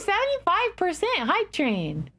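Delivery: high excited announcing. Real, not acted. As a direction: hype it up. Hype train announcement.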